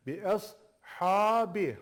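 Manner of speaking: slow, spelled-out "Bi es ha bi", thin sliding to thick. Shown as a demonstration. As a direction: spell it out slowly for pupils. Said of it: "İnceden kalına". (hece hece yavaş okuma)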